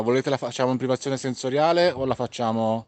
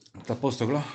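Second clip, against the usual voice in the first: after a workout. Real, not acted. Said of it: "Ah, fiatone".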